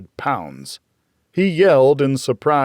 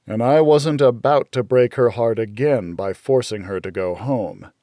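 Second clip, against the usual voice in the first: ordinary delivery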